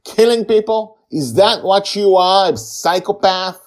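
high, furious accusing scream; screaming at her. A narrator's reading. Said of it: "he screams at her".

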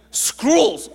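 loud, high, exasperated retort; exasperated repeat. Retold or imitated. Man repeating a misheard word to a confused woman, exasperated.

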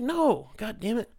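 retold exasperation. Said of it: "I was like".

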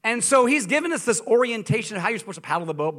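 ordinary delivery

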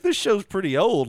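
dawning realization. Retold or imitated.

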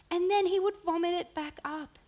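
laughing high and loud through the words. Real, not acted. Laughing while describing baby vomiting.

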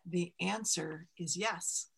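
a recarved mountain giving its first yes; slow mountain voice. Acted. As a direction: let the mountain pronounce yes, slowly and softly. The mountain answering Ma.

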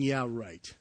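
eye-rolling sarcasm. Retold or imitated. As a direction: scoff at the claim quietly, trailing off on "Paul". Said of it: "people are rolling their eyes going".

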